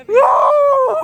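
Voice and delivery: high loud whoop; sledding downhill. Real, not acted. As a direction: shout for joy on the run. Sliding down the snow tube.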